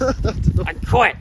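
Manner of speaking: squeaky indignant shout; high huffy outburst. Acted. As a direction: storm off in a high huff. Presenter quitting in high voice.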